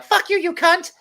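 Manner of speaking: put-on very high squeaky child voice cursing; little kid swearing. Dad imitating six-year-olds swearing online, squeaky high. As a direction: imitate a tiny kid cussing in game chat. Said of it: "They're like".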